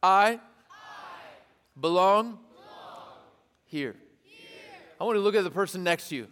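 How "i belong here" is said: call and response. Shown as a demonstration. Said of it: "Let's do this again one more time".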